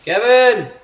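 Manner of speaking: high, loud shout for Kevin; real high-pitched holler; calling out. Real, not acted. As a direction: yell out for your companion. Calling out to companion.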